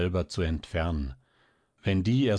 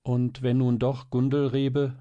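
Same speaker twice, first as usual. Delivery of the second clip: put-on higher, earnest child's voice; plucking up courage; 5 semitones higher, 3 dB louder than usual. A narrator's reading. Girl daring to speak up to mother, plucking up courage. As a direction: venture it bravely. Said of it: "sich ein Herz fassend fort".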